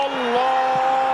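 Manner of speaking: slow stretched excited cry; drawn-out exclamation. Real, not acted. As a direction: draw out the cry. Goal moment exclamation.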